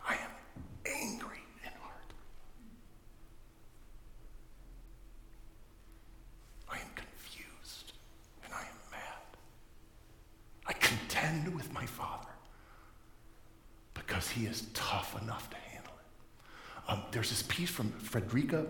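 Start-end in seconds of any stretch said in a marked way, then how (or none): 0.1-9.4 very soft, halting whisper; whispered confession. Acted. Preacher confessing anger and confusion to God, barely audible whisper. 10.7-16.9 soft voice falling into a whisper; hushed intensity. Acted. Preacher explaining why he contends with God, hushed whispery delivery.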